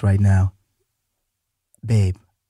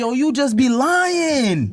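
mock outrage; 12 semitones higher, much slower than usual. Real, not acted. Playful accusation to wife.

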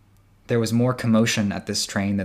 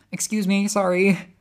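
polite apology; 10 semitones higher, 5 dB louder than usual. Acted. Slipping past others politely.

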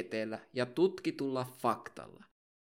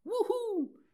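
whoop. Acted. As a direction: cheer like a fan at a concert. Cheer after each patron thanked.

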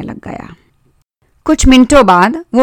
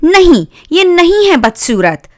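high, miffed little sister; annoyed, higher-pitched denial; irritated; 9 semitones higher than usual. A narrator's reading. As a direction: protest in a high, cross girl's voice. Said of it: "चिढ़ते हुए बहन ने बोला".

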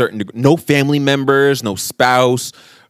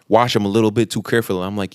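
ordinary talk

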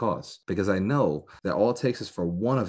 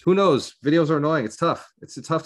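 exasperated outburst; 8 semitones higher, 6 dB louder than usual. Real, not acted. Venting about daily videos.

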